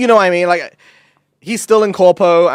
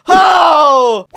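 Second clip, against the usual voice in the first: imitating a drunk friend's loud high shout; retold drunk shout; 12 semitones higher, 9 dB louder than usual. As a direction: yell it out like a drunk ranting friend. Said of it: "he was shouting".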